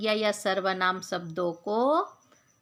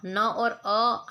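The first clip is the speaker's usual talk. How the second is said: slow spelling out; much slower than usual. Shown as a demonstration. Teacher splits letters for class.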